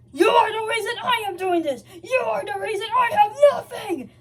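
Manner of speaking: high, loud furious shout with a sob in it; growling yell. A narrator's reading. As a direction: snarl it out in rage. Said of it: "said Sonic with a growl".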